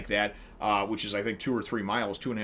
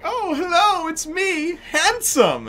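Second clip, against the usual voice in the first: much higher, louder, preening dandy voice; vain pretty boy; 6 dB louder, slower than usual. Retold or imitated. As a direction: show off as a smug beauty. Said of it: "this dude shows up and he's like".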